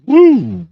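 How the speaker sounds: a loud, high whoop to kick off the show. Real, not acted. Host greeting his audience with a loud whoop.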